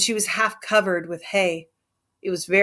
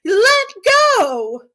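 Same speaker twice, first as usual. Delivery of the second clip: shrill, much higher, loud outcry; cry out; 13 semitones higher, 6 dB louder than usual. A narrator's reading. Curly's wife crying out for Lenny to let go. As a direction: yell it in alarm. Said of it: "she cried".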